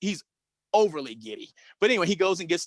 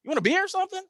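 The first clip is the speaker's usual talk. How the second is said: much higher, coaxing tone to his client; fawning agent; 13 semitones higher, much faster than usual. Retold or imitated. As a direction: agent sweetly offers Joe a beer. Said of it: "looks back at Joe and says".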